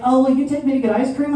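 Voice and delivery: put-on high, fast sweet child voice; kid asking sweetly. Comic imitating a child begging parent, high sweet voice. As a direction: wheedle like a kid who just melted down. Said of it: "they're like".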